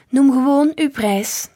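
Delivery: loud, slow, high-pitched demand; insistent. A narrator's reading. Woman pressing grandpa for a price, insistent. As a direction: press hard, drawing out each word.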